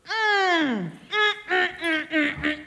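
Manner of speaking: high delighted mmm of tasting; savouring mmm. Acted. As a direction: hum with pleasure over food. Washu savours the meat.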